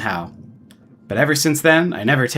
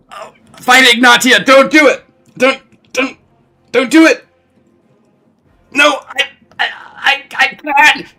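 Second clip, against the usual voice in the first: high, loud, frantic shouting; hyped shouting; 11 semitones higher, 7 dB louder than usual. Acted. Streamer yelling wildly at the game. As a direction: yell it like a hype-man losing it.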